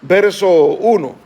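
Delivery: ordinary talk, nothing marked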